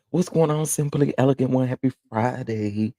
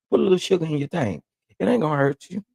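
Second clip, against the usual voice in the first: teasing; 7 semitones higher, faster than usual. Real, not acted. Teasing bland upstate viewers, fast and high. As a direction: playfully coax them to add sugar.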